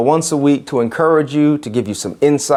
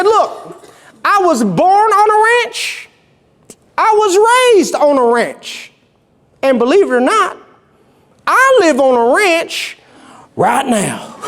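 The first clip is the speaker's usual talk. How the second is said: put-on East Texan accent. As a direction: imitate a proud East Texan rancher, pitched up. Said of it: "in this rich East Texan accent".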